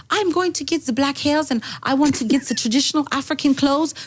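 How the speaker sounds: high mock German accent; German-accented, high and eager; Martina Big. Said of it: "she's like".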